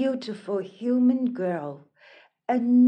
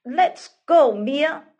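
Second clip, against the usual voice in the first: raised, insistent call to leave; urging; 4 semitones higher, 8 dB louder than usual. Acted. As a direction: urge her away firmly. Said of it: "urged the mermaids".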